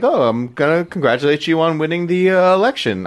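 a playful British prime minister impression; light put-on British accent offering congratulations. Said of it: "that's my British voice".

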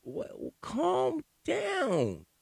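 very high, very slow condescending calm down; mocking calm down. Imitating the woman telling him to calm down, very high and slow. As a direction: drag it out, high and patronising.

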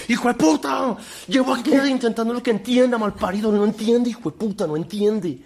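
high, loud screaming rage; furious yell. Retold or imitated. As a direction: explode at the smug partner. Imitating furious partner again.

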